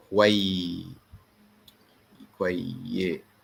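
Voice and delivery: kwayyisa said slowly while writing it; "kwayisa" slow, a broken-off start, then the full word; Egyptian Arabic. Shown as a demonstration. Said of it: "oh, I wrote it wrong".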